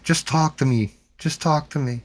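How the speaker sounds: a hint of a swooning girl begging; imitating smitten girls. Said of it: "Girls would literally be walking up to you like".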